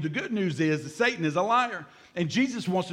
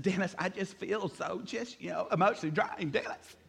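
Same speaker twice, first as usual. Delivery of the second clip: mock emotional confession; 8 dB quieter than usual. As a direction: act out pouring your heart out to Dennis, softly. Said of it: "I said".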